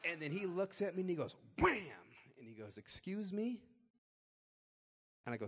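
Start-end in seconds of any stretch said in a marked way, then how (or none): 1.6-1.8 gavel bang noise. Retold or imitated. Judge bangs, imitated noise. 2.8-3.6 high, louder indignant "Excuse me?"; a judge caught off guard, voice jumping up. Retold or imitated.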